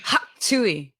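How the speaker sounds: "Huck Tui" said like an old cartoon character; cartoonish, bouncy "Huck Tui"; vintage cartoon voice. Shown as a demonstration. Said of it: "I'm saying it like a cartoon".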